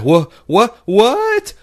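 imitating a shocked, confused robot; high rising "what, what, what?" in disbelief; mock startled android. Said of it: "I even think I saw her say".

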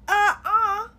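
sharp loud pained "uh-uh"; pained groan. Retold or imitated. Retelling the therapy session, a loud pained groan. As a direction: groan as the neck gives out.

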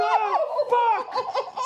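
high, drawn-out disbelief; slow high what the fuck in shock; laughing disbelief; stunned. Retold or imitated. Panicked reaction.

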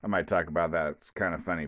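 normal talking voice

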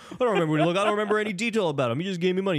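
high-pitched, hurried mock excuse; high, defensive retelling; mock protest. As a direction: imitate someone pleading ignorance in a high, rushed voice. Acting out Adam's evasive answers.

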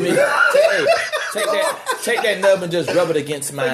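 laughing while talking. Real, not acted. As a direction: yell it out cracking up. Acting out a crude request.

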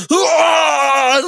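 high, loud battle yell; re-enacted rock-singer scream; hulking roar. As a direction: roar yourself back to life. Re-enacts hulking back to mic.

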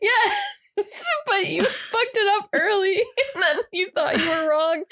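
high, laughing near tears; teasing through laughter; real crying laughter. Real, not acted. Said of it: "laughing at the mangled word".

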